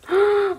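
a loud, high, delighted gasp; sharp gasp. Real, not acted. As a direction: gasp in happy shock. Opening a sweet card.

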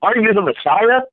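mocking question pitched high; taunting jeer at the man on the cross; angry mocking. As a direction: sneer the Messiah question up high at Jesus. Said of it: "kinda angry at Jesus".

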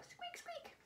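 two tiny, very high squeaks; mouse squeak. Pretend. Host praising kids as the mouse, high little squeaks.

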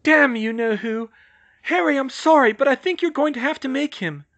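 high, loud, upset plea; voice catching. A narrator's reading. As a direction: plead loudly, voice pitched high with distress. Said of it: "and her voice caught".

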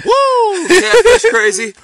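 high, loud whoop with a laugh; excited whoop. Real, not acted. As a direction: cheer the catch. Celebrating the landed catfish.

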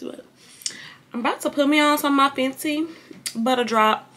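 ordinary talk

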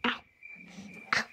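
ordinary delivery

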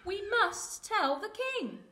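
high, slow, insistent chick plea; urgent chick. Acted. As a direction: plead urgently as a little bird. Chick pleads with fox.

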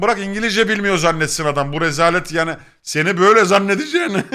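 high, loud retold scolding tipping into laughter; mock exasperation. Friend embarrassed him in English, laughing mock exasperation. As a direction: berate a friend, half laughing.

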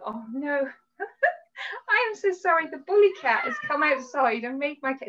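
high, loud, flustered apology. Real, not acted. Speaker to online group as a cat barges in, flustered. Cat interrupts her live session.

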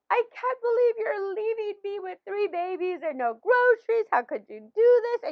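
high, loud, upset wail at her husband; hammed-up crying plea about being left alone; tearful complaint. Wife to husband leaving on a trip, mock tearful wail. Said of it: "I was crying. I was upset".

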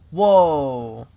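loud startled whoa. Real, not acted. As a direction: blurt it out loudly in real surprise. Gun fires unexpectedly.

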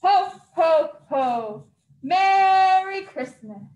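loud jolly shout with slow, drawn-out Merry Christmas; loud, slow, jolly Santa farewell. Acted. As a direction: call it over your shoulder to friends. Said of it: "he shouted out".

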